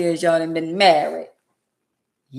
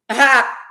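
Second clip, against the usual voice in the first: loud mocking cackle, high and loud; a sharp loud ha of disbelief; scoffing laugh; 5 semitones higher, 8 dB louder than usual. Real, not acted. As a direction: bark out a scornful laugh.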